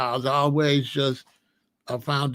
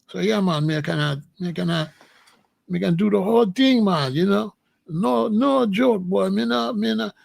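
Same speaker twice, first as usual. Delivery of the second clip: playful higher-pitched Jamaican patois; Jamaican accent; 4 semitones higher than usual. Shown as a demonstration. Showing listeners he can do it, Jamaican accent. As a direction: lay on the Jamaican yard talk. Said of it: "I'm just doing it with y'all".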